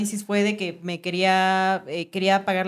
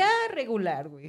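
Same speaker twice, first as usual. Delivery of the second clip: laughing, mock unimpressed; 3 semitones higher, 3 dB quieter than usual. Jokes about meeting the devil.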